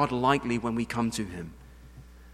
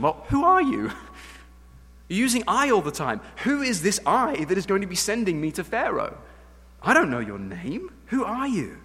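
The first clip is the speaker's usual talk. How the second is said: higher, pleading Moses voice; questioning Moses; 6 semitones higher than usual. Acted. Moses asking God his name, higher and bewildered. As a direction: ask like a baffled Moses.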